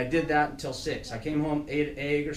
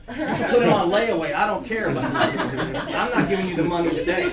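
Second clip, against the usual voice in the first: louder, higher, laughing as he says it; 4 semitones higher, 9 dB louder than usual. Retold or imitated. Dad telling the layaway joke to students, laughing. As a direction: laugh through the defiant answer. Retelling his reply to the clerk, laughing.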